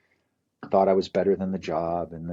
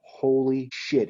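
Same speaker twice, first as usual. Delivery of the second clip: stunned disbelief; 5 semitones higher, much slower than usual. Retold or imitated. Cop stunned by his change.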